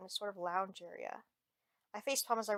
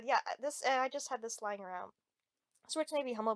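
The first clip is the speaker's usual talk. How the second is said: ordinary delivery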